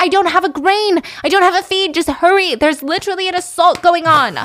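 high, loud panicked shouting; mock freak-out. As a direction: frantically plead with the police to hurry. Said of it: "[name] is freaking out".

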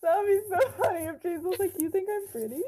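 amused. Real, not acted. Joking, laughing imagined quote.

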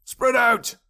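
high-pitched shouted order; distant shout. A narrator's reading. Pursuer orders the other men, distant shout. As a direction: call out across the woods. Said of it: "heard the men shouting to each other".

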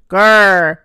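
loud, low storybook growl; bear growl. A narrator's reading. Great Bear answering the Great Pig, growling. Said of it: "growled the Great Bear".

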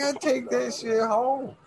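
eager voice. Retold or imitated. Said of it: "And you're like".

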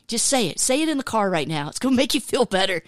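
ordinary talk

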